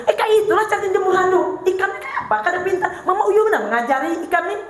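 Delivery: high, laughing mock scold of a clumsy child; scolding mother. Mother scolding child over towel. (ibu mengomeli anak soal jemur handuk, omelan pura-pura bernada tinggi)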